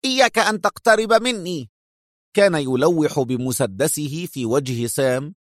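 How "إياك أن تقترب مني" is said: Eitan, much higher and tense; backing away, warning; sharp warning. A narrator's reading. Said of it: "بحركة حادة قائلة".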